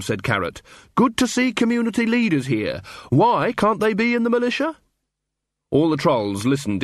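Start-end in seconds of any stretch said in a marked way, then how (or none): 1.0-4.8 much higher, hearty questioning voice; cheery officer. Acted. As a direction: greet them brightly, then challenge. Carrot cheerfully challenges the dwarf.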